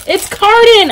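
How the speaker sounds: excited recognition. Real, not acted. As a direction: exclaim it high, thrilled at the discovery. Delighted recognition of character.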